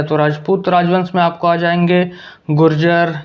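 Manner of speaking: usual speaking voice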